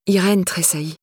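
ordinary delivery, nothing marked

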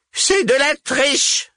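high, loud, drawn-out witch's howl; enraged yell. A narrator's reading. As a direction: howl the accusation, green with rage. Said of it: "hurla Vipera, verte de rage".

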